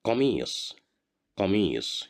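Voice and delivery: قميص pronounced carefully, teacher model; Arabic word said as a model. Shown as a demonstration. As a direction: sound out قميص for the class, ending on a firm emphatic s. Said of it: "we need a final صاد".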